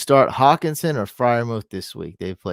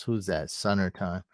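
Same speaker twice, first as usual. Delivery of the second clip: usual speaking voice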